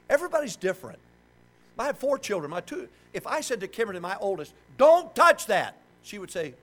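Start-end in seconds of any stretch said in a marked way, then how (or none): 4.8-5.8 put-on loud, high, sharp order to a child; stern fatherly command. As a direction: re-enact a father's loud scolding. Father's command to his oldest child.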